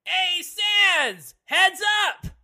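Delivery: high, loud playful call; playful shout. Acted. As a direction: yell a warning across the room. NPC calls out before throwing pillow.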